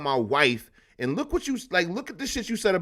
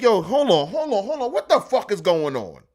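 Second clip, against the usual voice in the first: loud, high interruption, incredulous; acted husband's alarm; 6 semitones higher, 5 dB louder than usual. Husband interrupts wife's excuse, loud and incredulous. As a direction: cut her off with rising disbelief.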